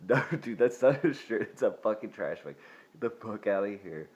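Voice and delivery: loud, fed-up "no, dude" at a customer; exasperated store clerk shouting him out the door; exasperated yelling. Retold or imitated. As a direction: raise your voice in disbelief and kick him out. Kicking out the trash-bag guy.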